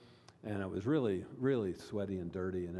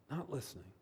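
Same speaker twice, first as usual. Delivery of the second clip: hushed repeat; 2 semitones higher, 8 dB quieter, faster than usual. Real, not acted. Softer repeat for emphasis.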